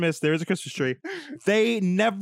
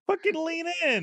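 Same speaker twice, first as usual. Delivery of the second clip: much higher joking squeal; high mock repeat; 11 semitones higher than usual. Cohost repeating the line in a much higher squeal. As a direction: pitch it way up for the bit.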